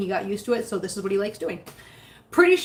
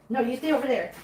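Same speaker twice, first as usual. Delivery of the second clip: usual speaking voice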